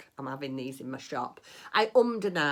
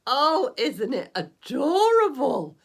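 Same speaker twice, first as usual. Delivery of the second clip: slow, high and much louder, gushing over it; delighted gush; 9 semitones higher, 10 dB louder, slower than usual. Real, not acted. Showing viewers her finished bunny, gushing with delight. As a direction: squeal with delight at the finished toy.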